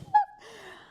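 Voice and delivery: shrieking, high laugh; real delighted laugh; high burst of laughter. Real, not acted. Reader laughs hard.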